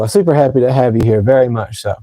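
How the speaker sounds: normal talking voice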